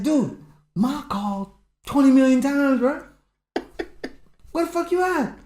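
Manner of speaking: high, loud panicked scolding; frantic brother. Retold or imitated. Brother panicking that mom called many times, high and loud. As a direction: panic that mom's been calling.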